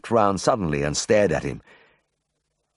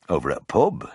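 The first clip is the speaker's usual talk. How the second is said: plain reading voice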